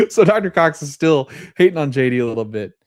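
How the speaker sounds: tickled by the jab; amused laughter. Real, not acted.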